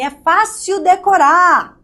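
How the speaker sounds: lightly mock a sweet old teacher, drawn out. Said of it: "Ela falava assim".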